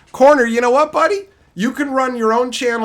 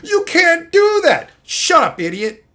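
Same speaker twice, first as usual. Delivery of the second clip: mock commenter; 10 semitones higher, 6 dB louder than usual. Streamer mocking a commenter, loud high nag. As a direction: ham up the nagging commenter. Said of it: "that's what I think when I see those comments".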